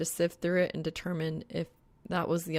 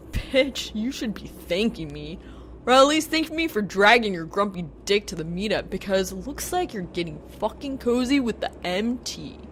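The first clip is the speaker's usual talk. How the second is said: loud, higher, mocking retort; scoffing; 4 semitones higher, 6 dB louder than usual. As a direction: scoff at him with sass. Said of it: "Blake scoffs".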